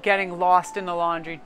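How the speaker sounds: usual speaking voice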